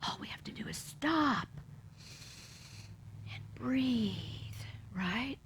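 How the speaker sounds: hushed, breathy stop; soft whisper. Real, not acted. Minister whispering to the congregation to stop. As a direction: drop to a whisper to calm them. Softly guiding listeners to pause.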